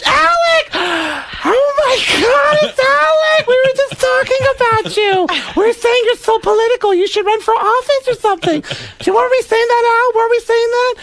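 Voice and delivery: very high, gushing clueless woman; Beverly D'Angelo. Acted. Clueless girlfriend greeting Alec at the elevator, high gushing squeal. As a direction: squeal a delighted greeting in falsetto. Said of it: "Beverly D'Angelo, completely clueless, gets off the elevator and goes".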